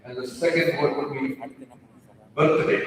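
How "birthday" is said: "birthday" in a broad Indian accent. Shown as a demonstration. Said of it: "stereotypical Indian accent".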